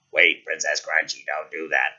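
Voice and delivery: deep-voiced pleading frog; talking frog. Frog talks to princess.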